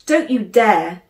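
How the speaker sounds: loud, sharp shout of alarm; proper shout. A narrator's reading. Sister shouting at her brother not to hide, loud. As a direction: yell to stop him vanishing. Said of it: "properly shouting".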